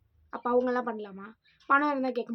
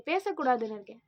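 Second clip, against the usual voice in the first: ordinary talk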